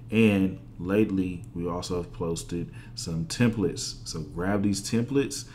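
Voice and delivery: slowed down, each item punched; slow emphatic pitch. Real, not acted. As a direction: sell it slowly, one by one. Sales pitch closing the tutorial.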